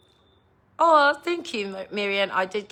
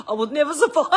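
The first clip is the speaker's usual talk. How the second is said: higher, louder laughing exclamation; 8 semitones higher, 7 dB louder, faster than usual. Real, not acted. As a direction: laugh through the words at the idea. Laughing at imagining jail.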